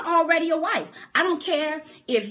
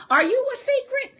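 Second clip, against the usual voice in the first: SECRET pushed high and hard; "secret" hit high and sharp; high pointed question; 11 semitones higher, 3 dB louder than usual. Acted. Preacher's rhetorical question.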